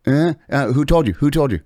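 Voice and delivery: mock furious scolding of a line cutter; retold telling-off. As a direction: boss the line cutter to the back. Demanding who allowed it.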